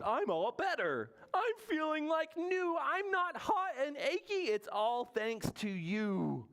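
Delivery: high, exuberant storybook bear; joyful crying out. Acted. Recovered Bear thanks his friends, high joyful cry. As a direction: cry out the good news in a high voice. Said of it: "Bear cries".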